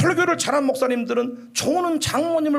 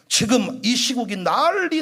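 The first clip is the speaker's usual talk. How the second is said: ordinary talk, nothing marked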